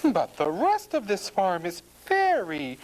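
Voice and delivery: high-pitched storybook housewife; farmer's wife. A narrator's reading. Farmer's wife planning her cleaning, high and purposeful. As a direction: announce it in a high woman's character voice. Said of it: "says the farmer's wife".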